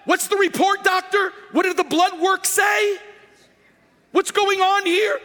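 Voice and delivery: frantic patient demanding the test results; panicked patient. Retold or imitated. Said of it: "This is going like".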